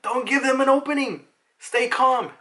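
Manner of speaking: high, loud mock Marine command; acted-out battlefield yell; shouted orders. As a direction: call it out to the troops, pitched right up. Voicing Marines calling out orders.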